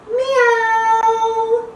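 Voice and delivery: high, drawn-out meow; playful high meow; high cat meow. Shown as a demonstration. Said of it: "And we say".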